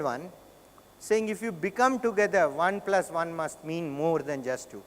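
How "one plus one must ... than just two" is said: ordinary delivery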